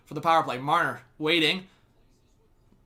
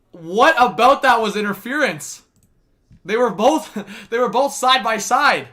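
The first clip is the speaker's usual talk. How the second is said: exasperated protest; 6 semitones higher, 9 dB louder, slower than usual. Real, not acted. Arguing against the interference call.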